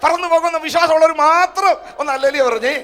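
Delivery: loud, high rallying call to the crowd; preaching call. Real, not acted. Pastor calling his church to shout hallelujah, loud and high. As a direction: rouse the congregation to shout back. Preacher calls congregation to respond.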